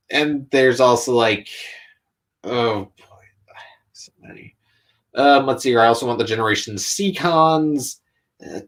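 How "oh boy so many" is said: breathless overwhelmed whisper. Real, not acted. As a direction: whisper it to yourself, daunted. Overwhelmed by long wish list.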